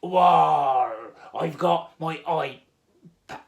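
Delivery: slow, drawn-out pirate drawl; terrible pirate accent. Pretend. Host showing off his pirate iPad, terrible pirate accent. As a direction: roar "arr", then drag it out as a pirate. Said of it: "my terrible pirate accent".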